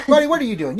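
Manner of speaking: high, loud call to the dog; real sing-out across the room; calling a pet. Real, not acted. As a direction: call out playfully to your pet. Calls to pet who wandered in.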